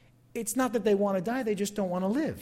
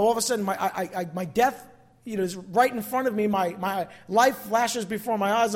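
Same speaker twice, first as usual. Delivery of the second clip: normal talking voice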